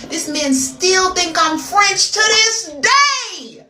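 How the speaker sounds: loud, high, drawn-out punchline; incredulous yell. Real, not acted. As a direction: build to a slow, high holler on the last word. Punchline yelled, then repeated quietly.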